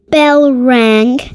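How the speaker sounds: usual speaking voice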